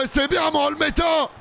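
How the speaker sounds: high cheering shout; joyful shout. Real, not acted. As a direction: cheer the save at the top of your voice. Keeper saves a penalty.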